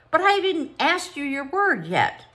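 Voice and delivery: high, loud startled exclamation; exclaiming. Acted. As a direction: caught off guard, burst out. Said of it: "the visitor exclaimed".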